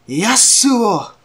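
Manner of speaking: shouted high and loud; battle shout. Acted. Izuku calls on his Yasuo form, a loud high shout. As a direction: yell the name out like summoning a hero. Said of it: "sorry for me being too loud".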